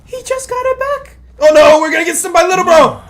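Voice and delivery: high, loud panicked yell; panicked shouting. Real, not acted. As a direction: shout in alarm at the screen. Streamer panicking at game opponent.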